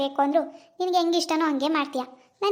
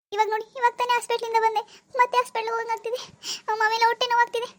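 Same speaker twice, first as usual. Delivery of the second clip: high, strained voice crying out in pain; moaning in pain, pleading with her mother-in-law; 6 semitones higher than usual. Acted. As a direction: beg for help through stomach cramps. Acted sudden stomach pain.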